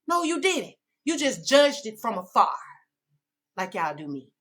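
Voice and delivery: usual speaking voice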